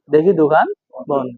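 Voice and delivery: normal talking voice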